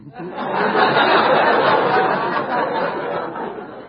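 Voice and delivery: real laugh. Real, not acted. Laughing at joke.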